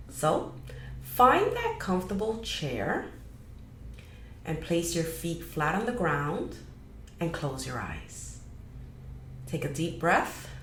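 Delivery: measured guiding voice with long pauses; guided meditation. Acted. Host guiding listeners to release energetic clutter, guided meditation. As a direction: lead them unhurriedly through a grounding meditation.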